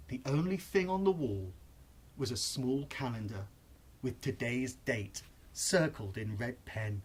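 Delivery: usual speaking voice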